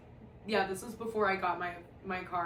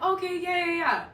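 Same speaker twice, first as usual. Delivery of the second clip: mock agreeing; 9 semitones higher, 7 dB louder than usual. Friends reluctantly agreeing.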